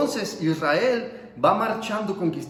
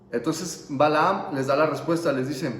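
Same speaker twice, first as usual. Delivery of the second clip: normal talking voice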